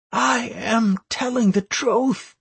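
hoarse and half choked. A narrator's reading. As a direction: rasp it out through a tight throat. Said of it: "said Anastaria hoarsely, half choked into silence".

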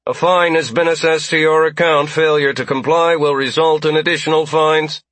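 loud, high robotic announcement. A narrator's reading. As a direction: droid reading out a violation code. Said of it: "a robotic voice announced".

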